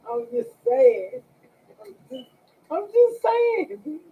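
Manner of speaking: high, slow, teasing sing-song; high mock-innocent teasing. Real, not acted. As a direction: tease them in a high drawn-out voice. Teasing after joke.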